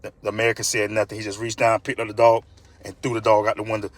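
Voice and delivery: ordinary delivery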